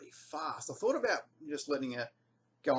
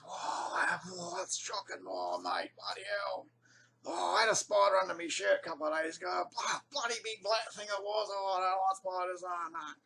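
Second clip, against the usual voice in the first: spooked bloke; 6 semitones higher than usual. Retold or imitated. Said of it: "and he goes".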